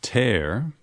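the teacher offers tear rhyming with air; option A. Shown as a demonstration. Teacher reading choice A, tear rhyming with air. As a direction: tear said as /ter/ like rip. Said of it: "Would you say A".

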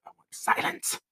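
hushed whisper. Retold or imitated. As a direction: breathe it as a whisper. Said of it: "I'm just like".

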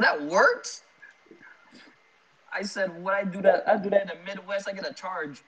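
lifted, amazed disbelief all through; incredulous. Retold or imitated. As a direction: marvel that it actually works. Amazed cousin's rudeness works.

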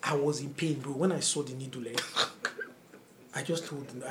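ordinary talk

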